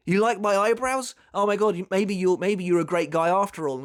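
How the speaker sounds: flattered delight. Retold or imitated. Renji won over by praise of his eyebrows, mildly acted delight. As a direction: play mildly touched, pitch lifted at first.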